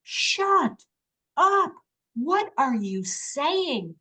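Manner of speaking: high-pitched, incredulous mock reprimand; scolding herself. Woman stops her own pleading, acted-out self-scolding. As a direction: tell yourself off, stretched out and high. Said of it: "And I said to myself".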